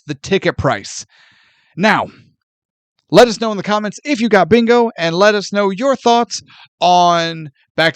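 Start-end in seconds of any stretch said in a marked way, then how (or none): none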